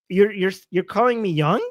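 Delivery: ordinary talk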